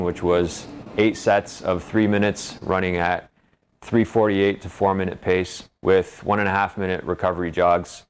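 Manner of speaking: normal talking voice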